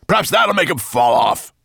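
shouted monster growl, pitched high. A narrator's reading. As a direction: growl it out at full volume. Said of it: "growled Nettlebrand".

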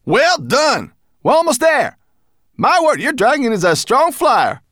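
high, loud, enthusiastic praise; cheery mountain creature, excited. A narrator's reading. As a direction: call out praise in a high character voice. Berber Chan's character voice.

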